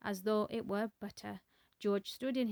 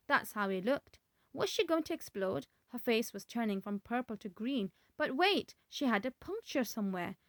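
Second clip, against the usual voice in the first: usual speaking voice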